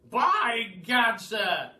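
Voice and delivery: loud, high exclamation; the Duke crying out, waving his stick; cried out. Acted. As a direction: burst out like an old colonel. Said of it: "he cried".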